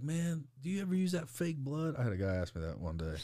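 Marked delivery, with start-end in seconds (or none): 0.0-2.0 high put-on voice of a clueless buddy; naive coworker. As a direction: ask it like a wide-eyed coworker.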